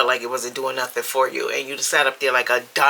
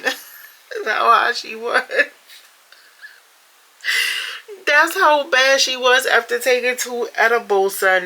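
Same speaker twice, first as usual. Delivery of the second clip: high voice cracking up with laughter; high, helpless near-laughing delivery; in shambles; 10 semitones higher, 4 dB louder than usual. Real, not acted. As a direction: struggle to talk through real amusement. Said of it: "These comments got me in shambles".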